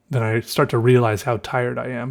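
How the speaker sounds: ordinary talk, nothing marked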